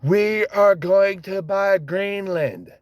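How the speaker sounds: drawn-out imitation of a grand declaration; mimicked politician's proclamation. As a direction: proclaim it slowly, mocking the politician. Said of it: "the son of a bitch is like".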